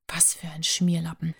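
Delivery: whispered under the breath; muttered insult. Acted. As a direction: let the insult slip out in a whisper.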